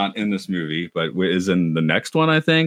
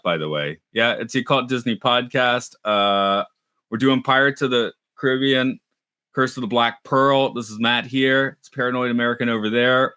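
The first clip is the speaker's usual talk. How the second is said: normal talking voice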